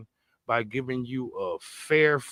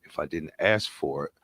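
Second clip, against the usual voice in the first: mock dismissive; 8 semitones lower than usual. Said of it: "when a person says out their mouth".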